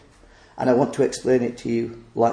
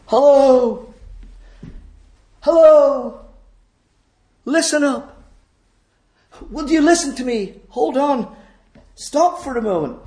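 high, loud roadside hail; calling out; 11 semitones higher, 4 dB louder than usual. Acted. Someone beside the broad road trying to stop the crowd, calling out. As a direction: call out to a passing crowd. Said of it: "calling out".

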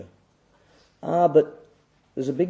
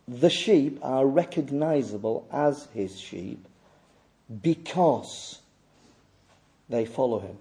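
slow, lower and softer, with long pauses; measured, hushed repetition, pausing around "because"; deliberate restatement; 3 semitones lower, 7 dB quieter than usual. Real, not acted. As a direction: spell the point out slowly and quietly. Repeats point slowly for emphasis.

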